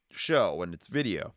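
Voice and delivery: normal talking voice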